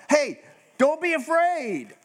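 high, slow, laughing reassurance; calm them down with a chuckle; reassuring angel. Retold or imitated. Angel calms a frightened person, high laughing reassurance. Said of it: "they always have to say what".

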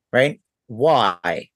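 usual speaking voice

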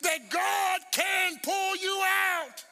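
high, forceful sermon climax; preaching. Real, not acted. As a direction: drive it home to the congregation, stretching the last words.

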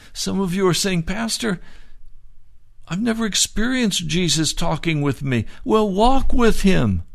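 rising revival preaching, urging them on; fiery preaching. Acted. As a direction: push it home to the congregation, loud and high. Preaching at full force.

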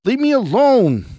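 very high, loud strained yell; screamed. A narrator's reading. Scott attacks the woman, screaming. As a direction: scream it at the top of the voice. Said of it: "let out a guttural scream".

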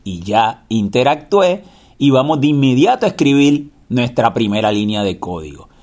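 normal talking voice

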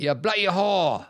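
hammed-up British insult, drawn out; British accent. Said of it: "how many times you do Shane Donovan".

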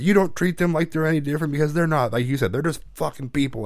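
retold angry lecture, not shouted; dad freaking out. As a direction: lay down the law as father. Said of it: "like, freaking out on me".

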